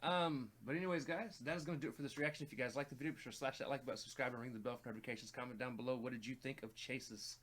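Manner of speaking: YouTube sign-off. Real, not acted. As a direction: rattle off the channel outro with energy. Reads the channel sign-off.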